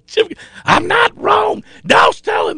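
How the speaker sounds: high shouted coach voice; mock yell. Coach shouting at his quarterback, mock yell.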